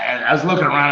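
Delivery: normal talking voice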